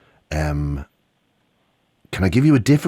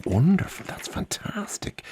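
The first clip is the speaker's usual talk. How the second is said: whispering; 8 semitones higher, 10 dB quieter, slower than usual. Real, not acted. Praising child softly on air.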